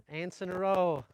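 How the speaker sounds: normal talking voice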